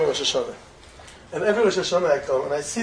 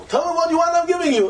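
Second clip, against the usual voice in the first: a lightly voiced king, pitched higher, grandly offering; the king's voice, higher and expansive, with a laugh; generous king; 10 semitones higher than usual.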